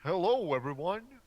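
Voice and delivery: high, loud cheery hello; happy Dumbledore. Acted. Friend reading Dumbledore greeting the students, high and cheery. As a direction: greet the hall like a jolly headmaster. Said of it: "he said happily".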